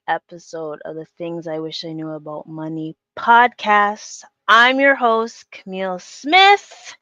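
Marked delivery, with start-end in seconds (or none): 3.1-7.0 loud, slow host announcement; announcer intro. Real, not acted. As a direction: announce the show proudly and slowly. Podcast intro, host announcing herself.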